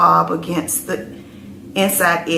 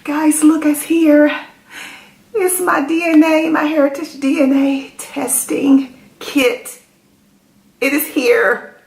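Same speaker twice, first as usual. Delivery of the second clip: excited; 8 semitones higher, 4 dB louder than usual. Real, not acted. Showing off the arrived kit.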